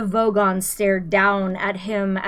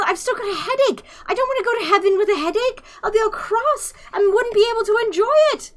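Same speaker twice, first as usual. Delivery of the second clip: high, fast, half-laughing, half-crying whine; panicked whine; 4 dB louder, faster than usual. A narrator's reading. Arthur pleading as he is dragged out, fast tearful whine. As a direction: babble it out fast and high, close to tears.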